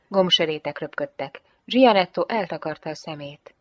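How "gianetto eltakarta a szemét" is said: usual speaking voice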